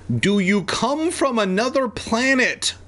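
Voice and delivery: high-pitched mild shout, incredulous question; shouting officer. A narrator's reading. Officer shouting up at Evan, high mild shout. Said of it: "the officer shouted".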